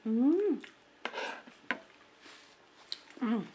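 humming with closed lips; mouth full, chewing. Real, not acted. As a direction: mm while eating pasta. Seen 2: Big forkful of spaghetti; closed lips chewing throughout the "mm" sounds.

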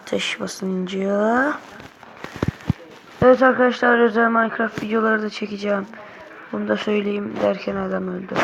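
ordinary talk, nothing marked

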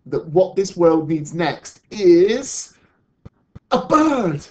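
loud, slow build to a high reveal; big storybook reveal, pitched right up; excited announcement. Acted. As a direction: draw it out, then proclaim the last word. Sue proudly announces her idea.

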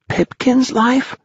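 very high, drawn-out question; high-pitched amazement; astonished. A narrator's reading. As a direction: ask it high and incredulous.

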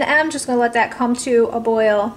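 ordinary talk, nothing marked

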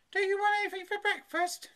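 high, loud mother's call. A narrator's reading. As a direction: call the child to breakfast, high-pitched. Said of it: "his mother called".

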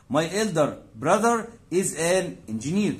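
slow teaching read. Shown as a demonstration. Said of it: "teacher reads English example".

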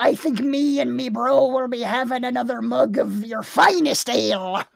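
lightly voiced pirate; a hint of pirate swagger. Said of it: "can you do a pirate voice".

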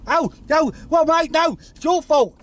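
panicked. Acted. Hit a kid, panicking.

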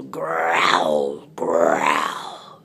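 very low, rolling growl; growling tiger. Acted. Tiger stuck in deep hole, low growl. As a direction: growl the word itself, drawn out.

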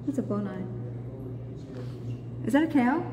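usual speaking voice

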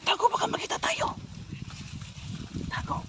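hushed whisper, ducking out of sight; whispering while hiding. Acted. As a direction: whisper so nobody hears. Hiding from the men.

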